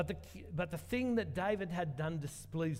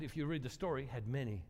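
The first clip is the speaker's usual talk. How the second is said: normal talking voice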